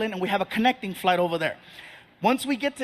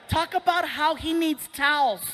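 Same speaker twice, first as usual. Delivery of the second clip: higher imitated mother's voice; impressed mom; 6 semitones higher than usual. Mom urging dad to shame their son, higher mock voice. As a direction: put in a request as the mom, pitched up. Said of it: "His mom was impressed".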